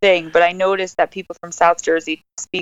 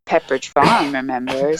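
Pepperidge Farm guy; 6 semitones lower than usual. Retold or imitated. Guest quoting a Family Guy episode, Maine ad-man impression. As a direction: lower Maine old-timer catchphrase, R dropped in Farm. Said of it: "like the Pepperidge Farm guy".

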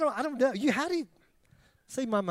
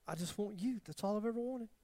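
much softer, tender voice of God; 2 semitones higher, 9 dB quieter than usual. Retold or imitated. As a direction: speak love gently to a broken soul. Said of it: "God is saying".

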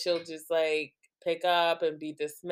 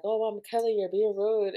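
imitated chiding grandmother; 5 semitones higher than usual. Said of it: "my mom will try to get her attention".

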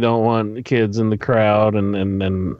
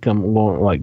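ordinary delivery

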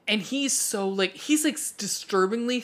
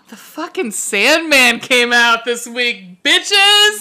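louder and louder, ending in a high yelled "bitches!"; excited shout; 4 semitones higher, 9 dB louder, slower than usual. Real, not acted. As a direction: build up to a triumphant high yell on "bitches". Excited announcement of new show.

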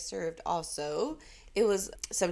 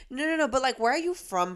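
higher-pitched imitation of insistent probing; pushy questioning; 6 semitones higher, 3 dB louder than usual. Imitating customers who are not genuinely interested, pushy. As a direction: press the question, mimicking a nosy stranger. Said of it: "because of how they're delivering".

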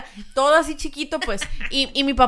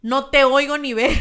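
louder, blunt Sinaloa shout; mock shout of a Sinaloa friend; mock loud yell; 2 semitones higher, 7 dB louder than usual. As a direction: bark it back across the street. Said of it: "hablan bien bajito".

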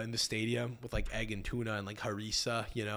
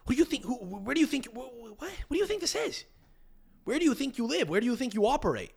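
mock outrage; 9 semitones higher than usual. Host ranting at Nice club: hammed-up high indignation. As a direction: scold the club like an outraged fan.

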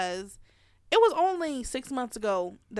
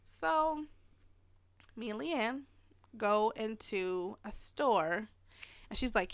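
ordinary talk

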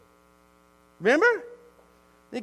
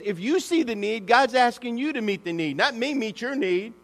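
ordinary talk

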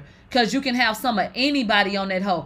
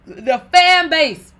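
slow, louder drawn-out disbelief; incredulous; 5 semitones higher, 8 dB louder, much slower than usual. Real, not acted. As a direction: savor how absurd it is. Incredulous emphasis.

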